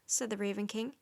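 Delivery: plain reading voice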